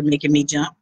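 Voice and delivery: ordinary delivery, nothing marked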